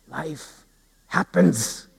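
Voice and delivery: soft, spaced-out answer; hushed preaching. Acted. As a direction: drop to a reverent hush with pauses. Preacher drops to a hush.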